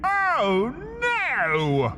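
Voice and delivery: a high, sudden panicked oh no. Acted. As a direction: blurt it out in dawning panic. Inner panic at being recognised.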